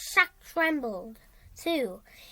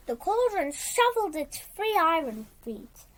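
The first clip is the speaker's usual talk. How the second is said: ordinary delivery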